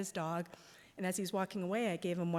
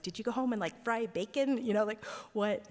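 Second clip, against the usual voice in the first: higher and louder, slowed on fry bacon; incredulous questioning; 4 semitones higher, 4 dB louder than usual. Retold or imitated. Quizzing the nurse about her returned smell, higher and louder disbelief.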